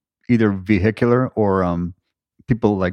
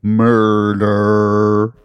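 dramatic announcer; 5 dB louder, much slower than usual. Acted. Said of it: "did I play this one up too much".

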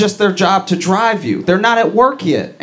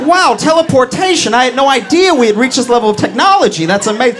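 mock amazement; 7 semitones higher, faster than usual. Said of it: "And you're like".

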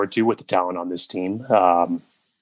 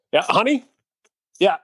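calling out to honey; 10 semitones higher than usual. Acted. Calling off to someone offscreen.

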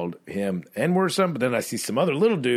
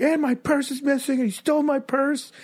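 put-on drunk girl. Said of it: "two drunk girls are trying to be like".

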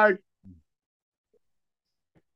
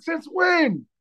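much higher, incredulous; incredulous question; 12 semitones higher than usual. Real, not acted. Still reeling at the outsourcing news, incredulous question. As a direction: demand it in disbelief.

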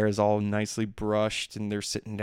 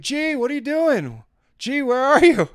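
wife calling; 7 dB louder than usual. Retold or imitated. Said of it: "and she's like".

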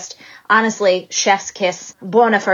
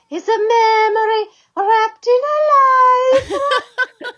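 Italian accent impression; much slower than usual. Asked by host to quote [name], hammed-up Italian accent. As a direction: stretch each word in a lilting hammed-up Italian accent. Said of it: "in your Italian accent".

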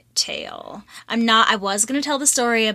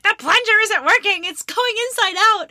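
retold yell; 11 semitones higher than usual. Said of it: "just basically yelling at him".

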